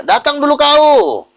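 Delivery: high, loud, slow demand; bossy order. Retold or imitated. A rude host summons the pastor from Surabaya, loud and slow. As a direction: order him to come, drawn out.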